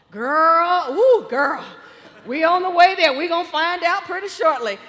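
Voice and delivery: put-on loud, giddy girlfriend gushing; giddy excitement. Sister-in-law on the phone awaiting team news, giddy and loud. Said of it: "giddy and waiting".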